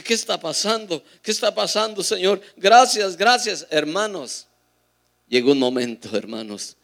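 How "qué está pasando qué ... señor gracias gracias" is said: higher, louder, awed cries of thanks; astonished praying. Retold or imitated. The man cries out to God as his chest swells, louder and higher. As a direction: call out to the Lord in wonder. Retold astonished prayer as fire comes.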